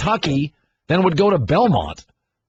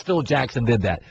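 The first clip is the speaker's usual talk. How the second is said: ordinary talk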